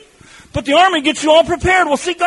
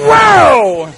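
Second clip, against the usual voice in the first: excited wow; 5 dB louder than usual. Retold or imitated. Thrilled after the first throw.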